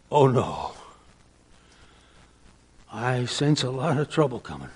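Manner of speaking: a hint of fearful Jeremiah; mock dread. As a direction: let the dread slip out quietly.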